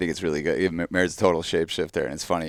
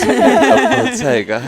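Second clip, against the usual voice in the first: mock British; 7 semitones higher, 15 dB louder, much slower than usual. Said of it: "A British person would never know".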